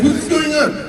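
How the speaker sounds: put-on high, loud confused old man; startled old man. Old man startled by a yelling stranger, high and confused. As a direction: squeak it out, bewildered. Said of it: "he was like".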